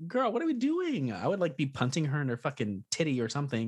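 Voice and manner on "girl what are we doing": higher, incredulous retold question; mock disbelief. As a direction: act baffled nobody helps. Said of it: "I'm like".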